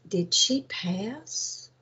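slow hushed whisper; whispering to the nurse at night. Retold or imitated. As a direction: ask it in a whisper, slowly. Asking nurse quietly at night.

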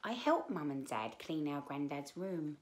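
plain reading voice

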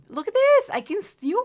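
excited. Real, not acted. Excited discovery in game.

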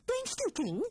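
high, light question; cheery rabbit question. A narrator's reading. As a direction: ask brightly as the rabbits. Rabbits asking.

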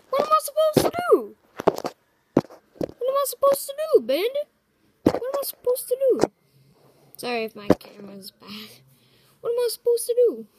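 high, loud, fast panicked repeats; panicked question. Acted. Actor asking in panic what to do. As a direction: cry it out in panic.

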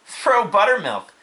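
put-on high, slow boy's voice; eager boy. Avery offering a remedy, high eager voice. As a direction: pipe up with a high suggestion.

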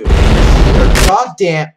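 slow, loud, angry drawl; angry snarl. Real, not acted. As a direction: drag out the anger. Raging at the game.

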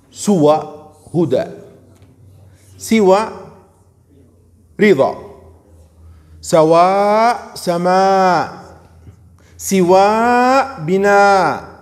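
سوى, سواء and rhyme words said loud and drawn out; teacher spelling out each variant of سوى clearly; demonstrating variant forms. Shown as a demonstration. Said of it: "سوى فيها كم لغات".

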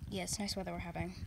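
flat cop. Acted. Cop replies flatly.

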